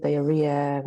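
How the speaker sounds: ordinary delivery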